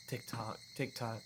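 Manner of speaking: whisper-soft counting to herself; faint tick-tock. Acted. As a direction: numb girl mumbling to the clock. Dazed girl counting clock.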